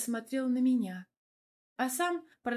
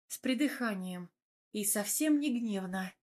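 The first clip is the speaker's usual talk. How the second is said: ordinary delivery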